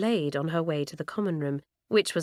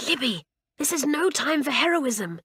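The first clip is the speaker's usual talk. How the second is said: higher, hissed warning; urgent hiss; 8 semitones higher than usual. A narrator's reading. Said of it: "hissed Janet".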